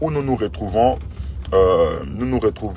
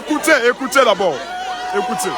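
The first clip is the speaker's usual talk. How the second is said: calling for attention; 12 semitones higher than usual. Real, not acted. Pastor quieting crowd.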